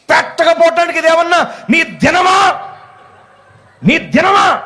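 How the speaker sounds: high-pitched furious shout, acted out; angry outburst. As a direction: burst out shouting in exasperation. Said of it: "కడుపు మండిపోయి ఉన్నానండి నేను కోపంతో". (కోపంతో అరుస్తున్నట్టు నటన)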